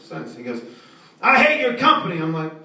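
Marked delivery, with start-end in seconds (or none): 1.2-2.2 high, loud mock caller rant; angry caller. Angry caller vents to phone rep. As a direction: rant like a furious customer.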